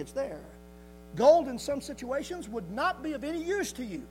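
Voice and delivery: fervent preaching. Real, not acted. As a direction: drive each point home to the congregation. Preaches with raised force.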